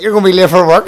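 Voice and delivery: joking loudly that she'll be late; loud teasing. Retold or imitated. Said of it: "And I was like".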